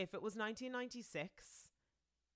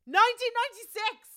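high, loud triumphant cry; excited shout; 14 dB louder than usual. Real, not acted. Finds the answer on Google.

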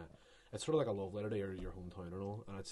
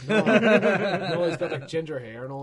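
loud high laughing through the words; laughing denial; 8 semitones higher, 17 dB louder, slower than usual. Real, not acted. Laughing hard as friend guesses Paul Rudd wrong. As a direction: burst out laughing while correcting.

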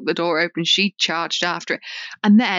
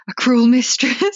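quoting a friend; 2 semitones higher, 4 dB louder, slower than usual. Retold or imitated. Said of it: "as Nessa would say".

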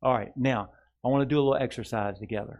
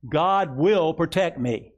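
slow and higher, proclaimed for the church to echo; slow, raised declaration; 4 semitones higher, 5 dB louder, slower than usual. Shown as a demonstration. Pastor leading the congregation in a slow proclamation. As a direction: proclaim it slowly and strongly. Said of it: "Say this with me".

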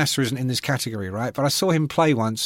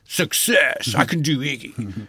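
[name] impression; 2 semitones lower, slower than usual. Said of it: "he goes".

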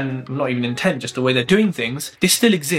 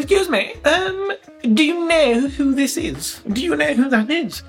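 1 mock polite soldier; 10 semitones higher than usual. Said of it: "and says".

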